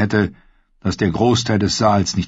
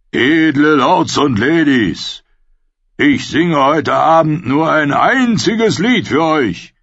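slow, higher showman's proclamation; booming minstrel introducing his song; grand announcement; 5 semitones higher, 3 dB louder, slower than usual. A narrator's reading. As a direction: proclaim it to a whole hall. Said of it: "verkündete er".

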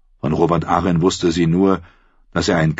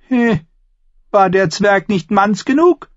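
high, louder, prying voice of Lysa; suspicious aunt; 5 dB louder than usual. A narrator's reading. Aunt Lysa grills Sansa about pregnancy, shrill and pushing. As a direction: press her hard for the truth.

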